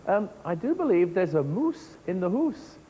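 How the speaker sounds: put-on broad Scottish accent, slowed down; a touch of Scottish, slow and playful. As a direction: play up a Scottish accent for the kids. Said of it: "That's just my Scottish accent".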